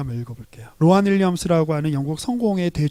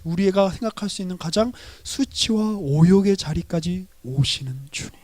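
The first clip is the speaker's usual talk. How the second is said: usual speaking voice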